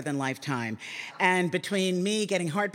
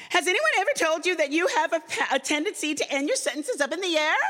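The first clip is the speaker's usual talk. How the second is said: much higher and fast, a snooty mock producer voice; 11 semitones higher, faster than usual. Narrator mocking the producer's note about her intonation, high snooty voice. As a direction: lampoon her prissy critique. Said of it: "She would say things to me like".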